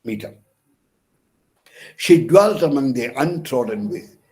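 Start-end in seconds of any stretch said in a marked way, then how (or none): 2.0-3.9 "She dwelt among the untrodden" with every second syllable punched; iambic stress. Shown as a demonstration. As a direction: recite "She dwelt among the untrodden" loudly in weak-strong beats. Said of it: "followed by stressed syllable".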